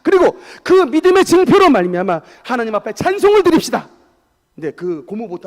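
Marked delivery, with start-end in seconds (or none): 0.0-3.9 loud, raised-pitch preaching call; shouted exhortation. Real, not acted. Preacher calls congregation to offer praise to God, shouted exhortation. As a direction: drive the call home at full force.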